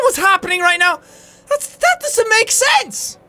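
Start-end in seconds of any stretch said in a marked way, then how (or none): 1.5-3.2 high, incredulous squeak; voice cracking. Real, not acted. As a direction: let your voice crack in disbelief. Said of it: "My voice is cracking at how crazy this is".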